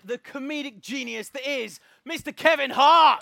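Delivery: loud announcer intro. Acted. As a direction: build it up like a ring announcer. Introduces the star guest.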